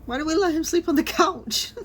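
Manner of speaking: hammed-up tearful complaint; mock crying whine. Real, not acted. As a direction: fake sob for the poor guy. Mock distress over character.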